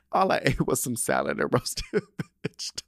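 laughing through the repeat. Real, not acted. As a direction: giggle through the words. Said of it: "important piece of the story".

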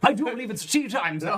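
high, hammy posh Brit; posh English accent. Pretend. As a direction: play the snooty English gent calling tea time.

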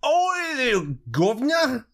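a playful Cockney-style British put-on; mock British accent. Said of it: "I can do the perfect British accent".

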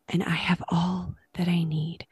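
soft whispered prayer. Retold or imitated. Prayer in the hospital room.

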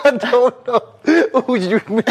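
high voice squeezed by laughter; laughing to tears. Real, not acted. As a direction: try to talk through a real laughing fit. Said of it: "get a close-up on these tears".

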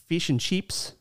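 Australian long "ee" in "fish and chips"; Australian accent. Shown as a demonstration. Co-host contrasts two accents, Australian "ee" vowels. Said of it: "I believe Australian is".

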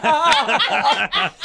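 high, forced ha ha ha; fake laugh. Laughing at a date's joke, a forced high fake laugh. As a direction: laugh a little too hard to cover it.

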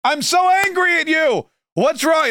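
high cartoonish Bernie outburst; Bernie Sanders ranting. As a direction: shake a fist at capitalism, hammed-up.

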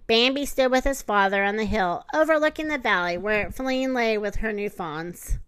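usual speaking voice

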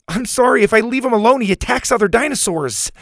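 put-on high, urgent kid voice; apologetic kid. A narrator's reading. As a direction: explain hurriedly to an angry teacher. Boy apologizes to angry teacher.

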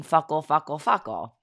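"fuckle" without the R, over and over; repeated mispronunciation. Retold or imitated. Daughter repeating it to make mommy laugh, "fuckle" with no R. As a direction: chant "fuckle" as the child says freckle, R missing. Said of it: "So we just keep repeating".

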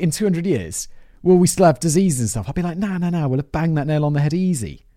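hammed-up bad Aussie accent; lightly put-on Australian twang. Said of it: "my bad Australian accent".

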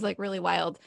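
normal talking voice

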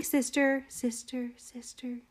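a high duck's call dying off; fading call. Acted. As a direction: let each sister fade like a far call.

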